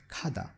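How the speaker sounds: Khada, aspirated K start; Korean word Khada. Shown as a demonstration. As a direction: say Khada with a breathy K. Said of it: "it is pronounced as K".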